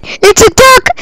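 high-pitched shrieks of alarm; terrified screaming. Real, not acted. Gamer spots a dreaded duck, terrified screaming.

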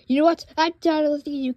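loud angry snap; loud fed-up shout; fed-up outburst. Acted. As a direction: blow up at him. Fed up with the villain.

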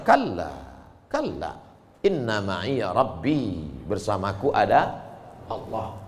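low, soft, slow reassurance; steady them with quiet faith; calm assurance. Acted. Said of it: "Apa kata Musa".

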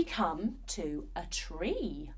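slow hushed whisper. Acted. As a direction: draw them in with a whisper. Sudden hush in story.

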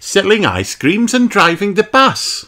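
very high cartoon rabbit voice; high-pitched, busy storybook rabbit; Miss Rabbit. A narrator's reading. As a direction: reel off the jobs in a high pitch. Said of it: "she says".

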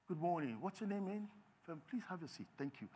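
receive a visitor with quiet formality; hushed, polite host; formal reserved greeting. Acted.

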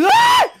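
high sharp startled shriek; startled scream. Real, not acted. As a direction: shriek in sudden fright. Sudden fright in game.

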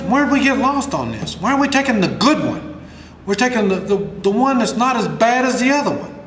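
ordinary delivery

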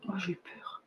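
breathless whisper, barely audible; frightened whisper to the camera operator; scared whisper. Real, not acted. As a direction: whisper it as if the live stream just froze on you. Camera nearly fails on live stream.

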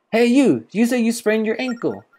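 high, loud accusing sidekick voice; indignant fairy companion. Acted. Fairy accuses man of faking injury, high indignant voice.

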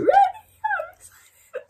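very high, drawn-out squeal; excited squeal. Real, not acted. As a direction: let it rise into a long thrilled shriek. Fan about to press play.

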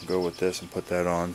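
normal talking voice

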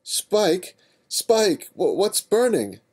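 high, loud alarmed calls; calling out. Acted. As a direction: yell through the smoke. Calling out in smoke.

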